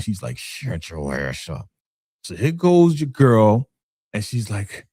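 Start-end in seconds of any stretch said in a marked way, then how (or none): 0.4-1.7 low, soft, drawn-out mock moan; imitated moaning. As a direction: moan the words slowly, barely voiced. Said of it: "she's moaning".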